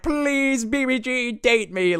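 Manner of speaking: high, whiny pleading impression; mock begging. Kid on his knees begs Crystal, mock pleading. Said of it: "got on his knees and begged".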